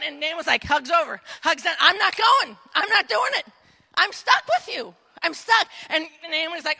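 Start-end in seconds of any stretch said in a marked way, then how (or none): 1.8-5.7 put-on high, insistent childlike refusal; cling on and flatly refuse; stubborn Ruth. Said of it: "Ruth's like".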